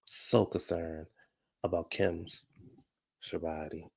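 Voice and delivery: slow, hushed sarcastic mock concern; slow sarcasm. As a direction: drag it out with sarcasm. Drawn-out sarcastic emphasis.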